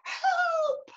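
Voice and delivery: put-on very high, thin child's squeal; tiny high-pitched storybook cry, not full volume; cry for help. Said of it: "shouted Eddie".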